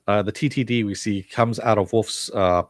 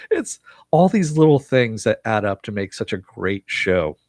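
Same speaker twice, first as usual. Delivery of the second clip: usual speaking voice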